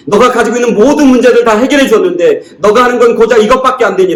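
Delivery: high, acted scolding in one rush; angry rebuke. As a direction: reproach them for wasting all that help. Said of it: "라고 해서 화를 내든지". (화가 나서 다그치듯 높은 목소리로)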